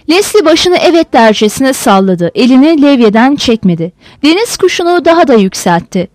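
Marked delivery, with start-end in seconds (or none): none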